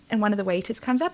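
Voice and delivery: ordinary delivery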